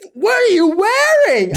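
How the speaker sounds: high, loud mock-indignant cry; incredulous outburst. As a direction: blurt it high and exasperated at the absurd outfit. Acted disbelief at gym outfits.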